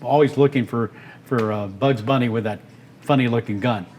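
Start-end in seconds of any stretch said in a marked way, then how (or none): none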